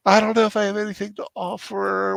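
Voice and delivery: high, self-pitying whine; whiny voice. Retold or imitated. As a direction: whine it like a sulky kid. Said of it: "Said in whiny voice".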